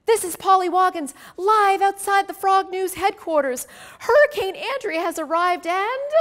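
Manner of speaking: high, loud news-reporter voice; reporter Polly. A narrator's reading. Polly reporting live from the storm, high and loud. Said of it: "As Polly was outside reporting".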